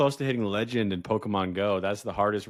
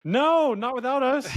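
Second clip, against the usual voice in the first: high-pitched protest; 4 dB louder than usual. Real, not acted. Friend played game without them.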